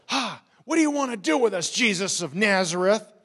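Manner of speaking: frantic, defiant demonic outcry; demon crying out. A narrator's reading. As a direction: yell it out at the top of the voice. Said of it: "cried out at the top of his voice".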